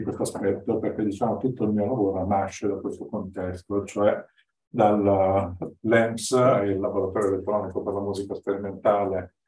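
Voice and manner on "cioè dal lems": ordinary delivery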